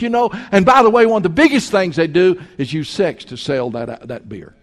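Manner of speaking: loud, high preaching against the ad men; full-force preaching. Acted. Preacher warning his flock about beer ads, loud and fired up. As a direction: preach it hard at the congregation.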